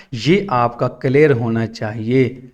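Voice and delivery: ordinary delivery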